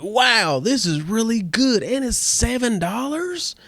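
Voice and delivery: high, amazed delight at a cheap pizza. Retold or imitated. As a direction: gush over how good it is. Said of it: "And you're like".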